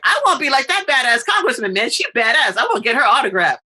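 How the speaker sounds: put-on high, fast, gushing girl voice; mock teen fangirling; excited girl. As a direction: gush like a starstruck kid. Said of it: "they're like".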